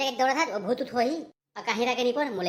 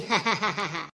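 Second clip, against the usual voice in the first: gloating ha-ha-ha; stagey villain laugh; evil cackle. Acted. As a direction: laugh like a scheming witch. Witches gloat over their plan.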